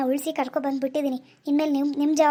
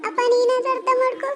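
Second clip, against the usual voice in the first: put-on higher girl's voice begging her father; pleading daughter; 8 semitones higher than usual. Daughter turns to her father, higher pleading voice. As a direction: plead with your father to understand.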